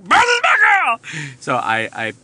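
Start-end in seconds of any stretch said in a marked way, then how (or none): 0.0-1.0 high, loud victorious cheer; shouting with glee that Bertha came through; triumphant shout. Retold or imitated. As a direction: yell it like you just won.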